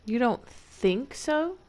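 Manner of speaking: usual speaking voice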